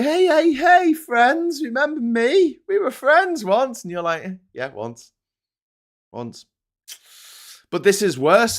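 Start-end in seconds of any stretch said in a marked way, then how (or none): 0.0-3.8 very high, loud mock pleading; squeaky, over-eager mock greeting; returning ex-friend. Acted returning friend pleading for recognition. 4.5-6.4 understated cold brush-off; unimpressed reply. Acted. Acted cold reply to returning friend.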